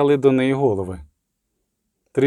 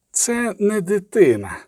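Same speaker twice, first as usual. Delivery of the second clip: high, slow, cold repetition; inhuman voice; 7 semitones higher than usual. A narrator's reading. Inhuman voice repeating that this is no child, cold. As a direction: repeat it slowly and uncannily, pitched up. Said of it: "повторив голос".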